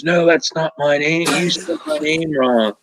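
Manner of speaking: mock indignant. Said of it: "she ripped my ass".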